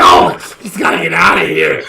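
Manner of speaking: imitating bosses throwing someone out; half-laughing retold yell; mock outraged shout. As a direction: bellow it in play outrage. Acting out bosses firing him.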